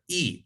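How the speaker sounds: "e" said ee, as in pizza; Italian-style vowel. Shown as a demonstration. Teacher contrasting vowels, "e" said as Italian ee. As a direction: say "e" as the long ee of pizza. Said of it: "like pizza".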